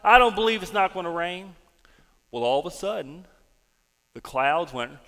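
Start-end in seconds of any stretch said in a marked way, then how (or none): 0.0-1.6 loud, angry king's retort; acted-out angry outburst; mad. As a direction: indignantly refuse to believe it, voice raised. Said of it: "Ahab got mad and he said".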